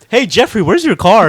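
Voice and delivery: high, loud call with laughter creeping in; imitated uncle calling. Uncle asking the nephew about his car, loud call. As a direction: call it out loudly, playing the uncle.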